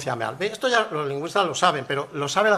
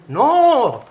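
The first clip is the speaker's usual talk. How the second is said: emphatic no; 6 semitones higher, 6 dB louder than usual. Acted. Mocking the manual's correction of readers.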